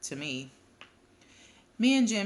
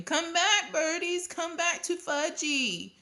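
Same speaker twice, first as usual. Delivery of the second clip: put-on very high little-boy holler; screaming toddler; 12 semitones higher, slower than usual. A narrator's reading. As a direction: cry after the fleeing birds, high and drawn out. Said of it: "was screaming".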